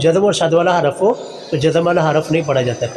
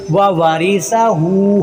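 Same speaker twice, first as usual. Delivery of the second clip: व वारिसा हू slowly, final hoo held; lengthened ha; much slower than usual. Shown as a demonstration. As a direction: stretch हू at the end. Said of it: "हा को लंबा करेंगे". (व वारिसा हू में 'हू' लंबा)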